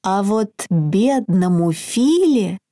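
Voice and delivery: usual speaking voice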